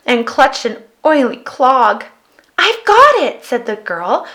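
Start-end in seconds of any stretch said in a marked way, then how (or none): 2.6-3.4 high, louder eureka; light up with the idea; bright idea. Acted. Girl hitting on a plan, excited.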